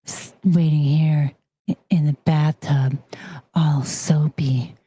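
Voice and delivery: sexy phone voice. Shown as a demonstration. Demonstrating a phone sex operator to viewers, low sultry coo. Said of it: "check this out".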